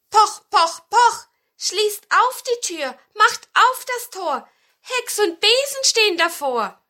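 hoher, lauter Rufvers der Hexe; rufend. A narrator's reading. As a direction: den Klopfspruch langgezogen und rhythmisch ausrufen. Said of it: "klopfte an und rief". (laut rufende Hexe vor der Tür)

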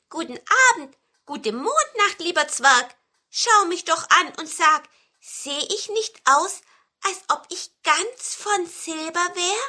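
high, lively storybook voice; proud pine cone. A narrator's reading. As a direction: show yourself off to a passer-by. Proud cone greets the dwarf. (hohe, stolze Zapfenstimme)

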